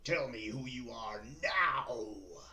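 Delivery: loud, slow furious villain demand. Shown as a demonstration. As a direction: bark it like an angry villain. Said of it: "I wanna sound villain".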